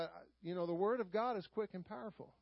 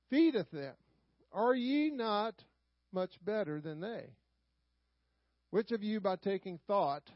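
louder, measured King James reading from the pulpit; solemn scripture reading; 5 dB louder than usual. Acted. Pastor reading Matthew to his congregation, solemn and declaiming. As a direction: read the old words out to the congregation with weight.

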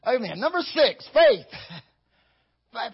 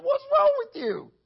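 much higher, sharp self-rebuke; scolding himself; 4 dB louder than usual. Retold or imitated. Preacher voicing himself scolding his own soul, high and sharp. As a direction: snap at yourself in a high voice. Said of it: "I gotta talk to myself".

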